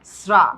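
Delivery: শ্রা with a sharp s, "sra"; s-like sound. Shown as a demonstration. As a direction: start শ্রা on a hissed s. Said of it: "দেখো, এস এর মতো". ("স"-ধ্বনিতে "স্রা")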